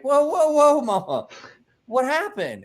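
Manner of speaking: high, loud startled whoa; alarmed whoa. Retold or imitated. To Mama bursting into the bar: high, startled whoa. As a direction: caught off guard, calm her down. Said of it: "And I'm like".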